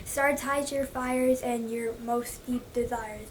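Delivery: slow, deliberate quoting; slow recitation. Real, not acted. Recalling a Macbeth line for viewers, slowly. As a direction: savour each word of the line. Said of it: "Do I remember the quote?".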